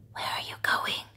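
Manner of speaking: a soft little fish whisper. Acted. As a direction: breathe the question like a tiny fish. Fish ask the travellers.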